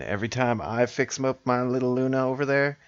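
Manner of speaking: normal talking voice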